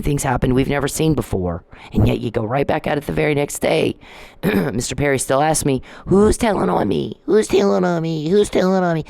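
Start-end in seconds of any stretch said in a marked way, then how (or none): 6.1-9.0 higher-pitched mimicking voice; high, singsong mockery; mocking imitation. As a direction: mimic a pestering man's repeated question. Mocking Mister Perry's repeated question.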